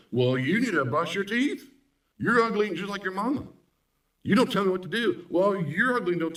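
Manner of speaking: high, mocking nagging parent voice; squeaky mock scolding, like a bickering parent; critical parent fussing. Counselor mocks spouse turned critical parent, fussing high. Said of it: "start fussing".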